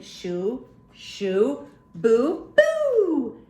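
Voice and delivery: loud high spooky boo; hammed-up monster boo; mock scary boo. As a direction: spook the kids playfully. Reading scary monster's boos.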